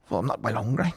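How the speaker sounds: low, quiet mock reply; sheepish retold reply. Customer admitting to waiter he isn't hungry, quietly sheepish. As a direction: drop it right down, sheepishly.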